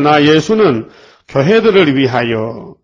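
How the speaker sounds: slow, solemn verse reading; scripture reading. Acted. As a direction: draw the verse out for the flock. Reads scripture verse slowly.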